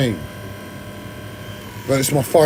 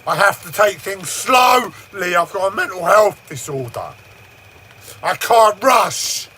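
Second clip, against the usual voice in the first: exasperated outburst; 3 semitones higher than usual. Real, not acted. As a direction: drag the words out in frustration. Venting about stress and disorder.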